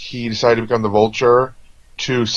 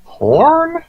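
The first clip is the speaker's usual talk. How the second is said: caught-out Ned; much slower than usual. Retold or imitated. Ned caught by the teacher, squeaking out his excuse. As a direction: blurt the excuse, voice flying up. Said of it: "And he's just like".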